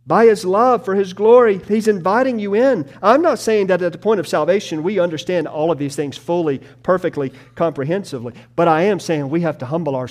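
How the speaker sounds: loud, high, driving sermon delivery; fervent preaching. Acted. Preacher urging the congregation, loud and fervent. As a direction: preach it out with full force.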